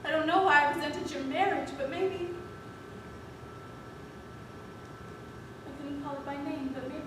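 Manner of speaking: very soft, halting and low; quiet, troubled admission; hushed confession. Acted. Halting confession in a drama scene.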